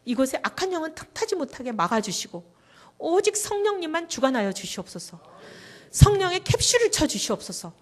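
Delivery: prayer building from plain to loud and high; a retold prayer swelling into loud fervour; fervent retold prayer. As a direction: plead with God, rising to a near shout. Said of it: "이렇게 기도하고 있는데".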